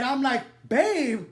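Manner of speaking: loud, high "Babe?" in disbelief; shocked disbelief. Retold or imitated. Hookup hearing him call her babe, shocked. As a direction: throw it back at him stunned.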